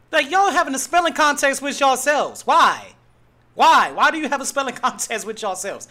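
loud, high-pitched disbelief; exasperated ranting. Real, not acted. Radio host ribbing Louisiana fans over their spelling, exasperated. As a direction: demand an answer, loud and incredulous.

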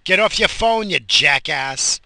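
furious road-rage yell at a phone-distracted driver; angry shout. Real, not acted. Yelling at driver ahead.

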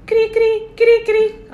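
high mock cricket chirping; cricket chirps. Mocking silence with cricket sound.

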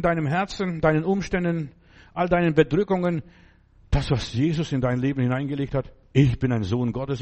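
ordinary delivery

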